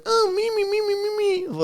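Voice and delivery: very high, squeaky cat-cooing imitation; mocking baby talk. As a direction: mimic silly baby talk to cats in a sky-high voice. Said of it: "doing the baby talk thing with the cats".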